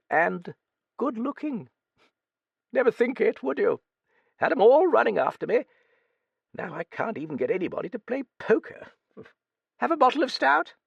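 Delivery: put-on high, crusty old British lady voice; old Duchess. A narrator's reading. Old Duchess quizzing the young writer, high crusty voice. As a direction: play a brusque, nosy old dowager.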